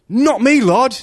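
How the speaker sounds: high, loud, eager; eager boast. Acted. Peter boasting to Jesus.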